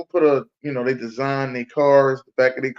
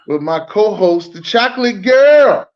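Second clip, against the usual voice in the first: announcer introduction; 7 semitones higher, 9 dB louder than usual. Real, not acted. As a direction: build to a loud, high co-host reveal. Host announces his co-host.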